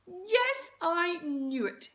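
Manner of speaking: loud, high mum cry of triumph; excited cry. A narrator's reading. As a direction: burst out, knew it all along. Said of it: "cried Mum".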